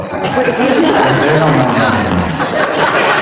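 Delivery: drawn out slowly through a laugh. Real, not acted. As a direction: laughs it off, stretching every word. Dismissing Wikipedia, laughing.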